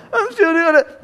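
whiny complaint. Pretend. Elijah whining to God.

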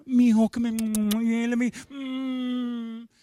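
mock coddling mom. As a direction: ham up a coddling mother soothing her boy. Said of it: "she's not the kind of person to be like".

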